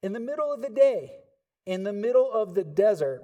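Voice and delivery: rising, loud preacher's build; preaching. Acted. Preacher building the desert scene, loud and rising. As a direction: preach it with mounting force.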